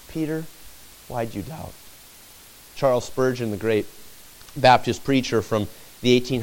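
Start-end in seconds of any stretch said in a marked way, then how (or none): 0.1-1.7 soft, gentle question to Peter, much quieter; lovingly. Retold or imitated. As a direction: ask him tenderly, almost under the breath. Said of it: "lovingly say".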